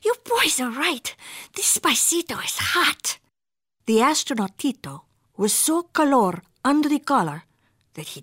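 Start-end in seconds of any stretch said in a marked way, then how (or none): 0.0-3.2 panting. A narrator's reading.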